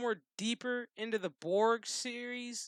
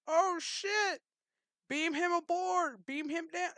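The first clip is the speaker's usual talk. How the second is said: pitched way up in sudden alarm; panicked shout; 10 semitones higher, slower than usual. Pretend. Riker at his ship, suddenly calling a beam-up in alarm. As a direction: yelp out the order in a panic. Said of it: "and you're like".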